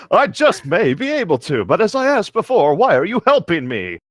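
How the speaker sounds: a wary griffin pressing again. Acted. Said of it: "Dalamar questioned me again".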